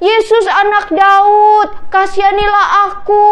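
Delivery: pleading shout. A narrator's reading. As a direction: call out to someone far off, stretching the words. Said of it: "Langsung dia teriak".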